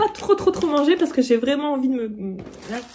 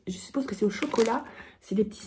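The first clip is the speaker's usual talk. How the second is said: chuchoté; 7 dB quieter, faster than usual. Real, not acted. Devine le contenu.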